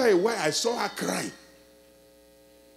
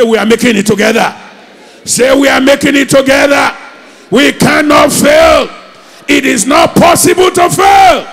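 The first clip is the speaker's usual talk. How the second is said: loud, high rallying shout to the congregation; shouted declaration; 5 semitones higher, 15 dB louder than usual. Real, not acted. Preacher leading the crowd in a loud shouted confession. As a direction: shout it with full faith. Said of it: "Turn around to somebody and say".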